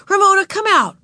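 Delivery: high, loud commanding call; stern order. A narrator's reading. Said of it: "she ordered".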